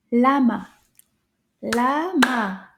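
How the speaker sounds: firm "Lama" in two even beats; clapped-out syllables. Shown as a demonstration. Teacher clapping syllables with children, emphatic and measured. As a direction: beat out both syllables of "Lama". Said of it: "Let's clap those syllables together".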